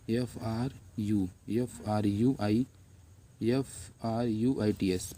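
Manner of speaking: letter by letter, slow enough for students to write; slow classroom spelling; slowly spelled. Shown as a demonstration. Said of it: "मैं धीरे धीरे बोल रहा हूँ".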